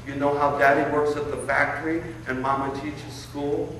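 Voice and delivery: ordinary talk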